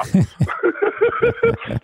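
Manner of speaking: real laugh, high; high burst of laughter. Real, not acted. Laughing at his own bar story.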